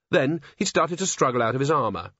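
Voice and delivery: ordinary delivery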